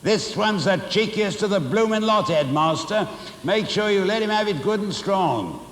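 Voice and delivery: high-pitched spiteful screech; screeching Missus Pratchett. A narrator's reading. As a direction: urge the headmaster to hit harder, with relish. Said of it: "high-pitched voice behind me screeching".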